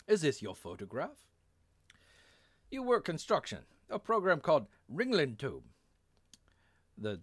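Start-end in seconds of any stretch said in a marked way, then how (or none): 0.1-5.7 higher, put-on English-German accent; hammed-up foreign officer's accent. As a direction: question a prisoner in a mixed English and German accent. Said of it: "how can you do an English accent in German".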